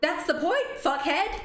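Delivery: high, laughing insult; cheeky high laughing jab. Real, not acted. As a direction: laugh out the retort. Laughing retort at character.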